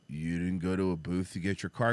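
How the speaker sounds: low dull clerk voice; dopey attendant. Retold or imitated. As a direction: play him dim and puzzled.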